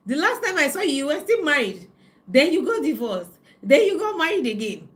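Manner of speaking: high, loud, teasing scold; loud mock friend scolding. Imitating her friend's loud disbelief about remarrying. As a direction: ham up the friend's mock outrage. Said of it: "she said".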